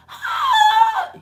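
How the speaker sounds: a strained high gasping shriek; cracked high squeal. Shown as a demonstration. Showing viewers her damaged voice, cracked high squeal. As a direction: show how the voice breaks up high. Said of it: "hear how that sound?".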